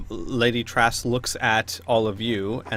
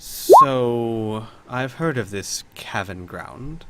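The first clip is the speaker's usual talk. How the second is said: slow, hushed, serious noblewoman; a drawn-out opener, then drop to a near-whisper; Lady Trask; 2 semitones lower, much slower than usual. Acted. Baroness weighs up the named thief, hushed seriousness. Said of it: "taking this a little more seriously".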